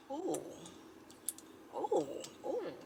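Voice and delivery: quiet little swooning oohs; admiring ooh. Acted. Ogling to herself at the gym, softly admiring. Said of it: "I be like".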